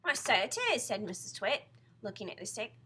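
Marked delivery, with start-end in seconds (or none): 0.0-0.8 raised, louder retort; insistent wife. Acted. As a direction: insist it to your husband. Mrs Twit argues with her husband.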